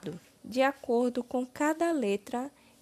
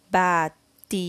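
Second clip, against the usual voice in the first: BATIZADO broken into ba, ti, za, do; syllable by syllable; 5 dB louder than usual. Shown as a demonstration. Teacher completing the word with syllable ba, syllable by syllable. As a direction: sound out BATIZADO with a gap after each syllable. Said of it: "Iremos utilizar a sílaba ba".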